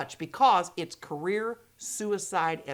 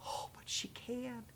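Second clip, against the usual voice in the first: slow breathy whisper of relief; whispered thought; 3 semitones higher, 19 dB quieter, slower than usual. Retold or imitated. Friend sighing over coffee, whispering quietly. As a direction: whisper it as a private realization. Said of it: "I took a big sigh and thought".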